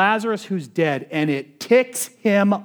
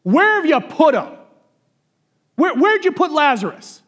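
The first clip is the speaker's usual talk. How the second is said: Jesus demanding where Lazarus is laid, angry shout; 9 semitones higher, 6 dB louder than usual. Retold or imitated. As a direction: bark the question at the mourners, furious. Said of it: "I gotta say this the way that Jesus would have said it".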